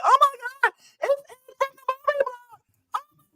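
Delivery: very high-pitched mock fangirl squeal; gushing starstruck fans. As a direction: imitate celebrities gushing in a tiny high voice. Mimicking starstruck celebrities greeting Ant.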